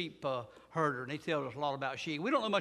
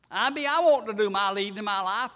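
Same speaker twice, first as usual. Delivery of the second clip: stubborn self; 3 semitones higher, 9 dB louder than usual. Acted.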